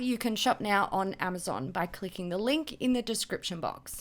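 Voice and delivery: polished sponsor read voice. Acted. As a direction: read it like a commercial.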